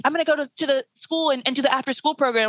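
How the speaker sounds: high-pitched, mock-eager helper voice; condescending do-gooder. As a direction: imitate a self-pleased volunteer, pitched well up. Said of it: "That sounds really condescending".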